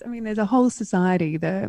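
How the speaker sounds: usual speaking voice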